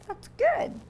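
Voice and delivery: squeaky, high that's good; high sing-song good. Acted. Reading to kids, high cheerful reply. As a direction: pipe it up brightly.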